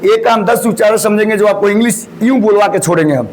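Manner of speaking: normal talking voice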